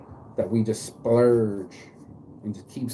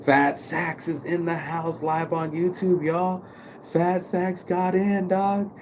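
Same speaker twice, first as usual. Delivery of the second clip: hype intro; 7 semitones higher, slower than usual. Real, not acted.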